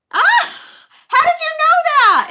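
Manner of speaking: high, loud excited shriek; delighted amazement. Real, not acted. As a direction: squeal in happy disbelief. Amazed by a right answer.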